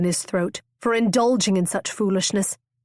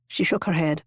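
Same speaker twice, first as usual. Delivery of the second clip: plain reading voice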